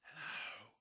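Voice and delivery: a soft, hissed hello like a snake; hissing snake. A narrator's reading. Snake hissing a greeting to the jungle. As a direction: breathe it out as a snake. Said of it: "the snakes would hiss".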